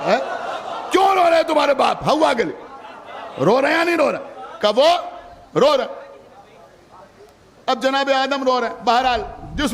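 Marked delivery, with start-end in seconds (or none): 0.1-4.3 fervent preaching. Real, not acted. Preacher to the majlis gathering, fervent full-force preaching.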